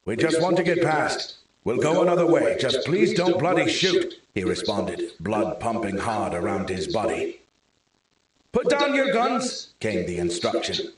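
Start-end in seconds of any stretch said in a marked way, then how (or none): 0.0-4.2 higher, louder, urgent plea; pleading shout. A narrator's reading. Man under fire shouts to the gunmen, pleading loudly. 8.5-9.7 much higher called-out command; shouted order. A narrator's reading. Scottish guard orders the stranger to disarm, a shouted command.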